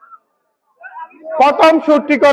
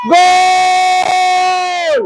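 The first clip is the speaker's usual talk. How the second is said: goal shout; 4 semitones higher, much slower than usual. Real, not acted. Commentator calls penalty goal.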